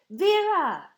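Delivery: high, loud call of a happy reunion; joyful cry. A narrator's reading. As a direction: call out an old friend's name with delight. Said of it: "Bellini cried as they embraced".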